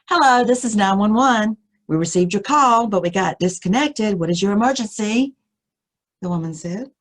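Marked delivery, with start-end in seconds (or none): 0.1-5.3 higher, louder concerned 911 operator voice; concerned dispatcher. Retold or imitated. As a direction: voice a worried operator asking urgently. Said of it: "Sounded very concerned".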